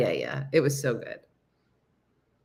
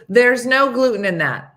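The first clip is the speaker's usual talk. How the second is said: loud, higher insistence; firmly insisting, louder and higher; emphatic retort; 5 semitones higher, 8 dB louder than usual. Retold or imitated. Friend across the table corrects her about the paella, emphatic retort.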